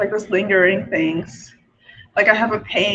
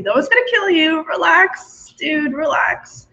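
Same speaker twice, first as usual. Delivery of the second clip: higher, exasperated talking-down; insistent self-reassurance; 7 semitones higher, slower than usual. Acted. Telling her scared self to relax, playful insistence. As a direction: urge a panicky self to chill, pitched up. Acted argument calming fearful self.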